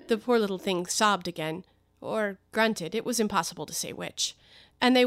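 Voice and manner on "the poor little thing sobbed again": ordinary delivery, nothing marked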